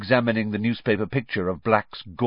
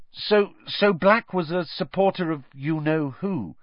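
tentative, higher-pitched prompt; apologetic; 9 semitones higher than usual. A narrator's reading. Harry coaxing Stan to go on, apologetically. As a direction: ask hesitantly, as if apologising. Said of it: "Harry prompted apologetically".

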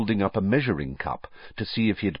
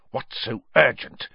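higher, lightly hissed demand; Snape, hissing; 4 semitones higher, 3 dB louder than usual. A narrator's reading. Snape to Karkaroff after class, hissed. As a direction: hiss the question impatiently. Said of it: "Snape hiss at Karkaroff".

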